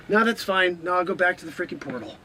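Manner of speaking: ordinary delivery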